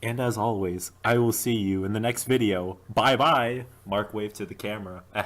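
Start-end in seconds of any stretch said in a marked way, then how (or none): 0.0-3.6 upbeat, louder YouTuber sign-off; video outro voice. Acted. Mark signs off to his camera, upbeat and loud. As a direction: wrap up the video for the fans.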